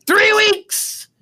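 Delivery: high screamed impression of an actor; imitated scream. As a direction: shriek the misremembered movie line, very high. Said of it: "Like, he screams it".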